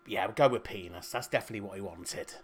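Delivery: low, quiet mock deliberation; conspiratorial undertone. Registrar settling the baby's name with a colleague, hushed undertone. Acts out officials conferring on name.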